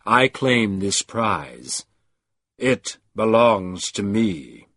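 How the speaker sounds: a dark power claiming its prize. A narrator's reading. Deep voice lectures the creature.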